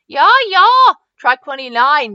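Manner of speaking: put-on high, loud German-accented voice; eager German-accented reply. A narrator's reading. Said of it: "replied with a German accent".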